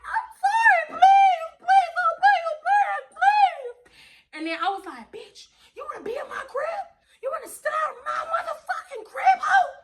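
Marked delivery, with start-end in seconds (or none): 0.0-3.8 much higher, loud desperate begging; imitating the thief screaming for mercy; shrieking plea. 5.1-9.7 threatening yell. Retold or imitated. Threatening the thief.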